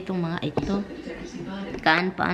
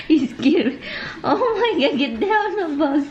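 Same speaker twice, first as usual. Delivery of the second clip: high, laughing yelps at the pet; laughing while startled; 7 semitones higher than usual. Real, not acted. Startled by the pet jumping up, laughing and squealing. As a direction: laugh it out, half scared, much higher. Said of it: "I'm laughing right now because you scare me".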